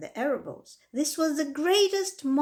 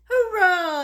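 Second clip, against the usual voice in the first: much higher, louder cheering yell; crowd yell; 9 semitones higher, 10 dB louder than usual. A narrator's reading. The crowd cheering Wilbur back on his feet, loud yell. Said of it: "yelled the crowd".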